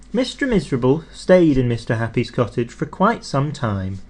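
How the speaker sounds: plain reading voice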